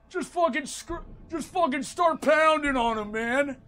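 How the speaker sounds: high, mocking imitation of a game coach; hammed-up boxing trainer pep talk; Sylvester Stallone. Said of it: "wrote those fucking voice lines".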